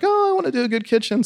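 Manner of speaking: high, fast, mock-eager wife; a hammed-up excited wife. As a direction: chirp it like a wife dreaming of kitchens. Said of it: "my wife is like".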